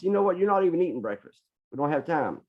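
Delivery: high, exasperated scolding; retold yelling. Dad refusing his daughter breakfast for lack of time, yelling. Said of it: "I was so busy yelling".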